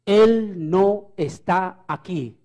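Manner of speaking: slow, weighty reading of each word; reading the sign. Shown as a demonstration. Preacher reading the tomb sign to the congregation, slow and weighty. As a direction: read it out slowly, letting every word land. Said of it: "el letrero dice".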